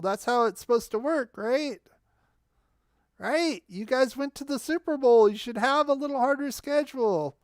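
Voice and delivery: normal talking voice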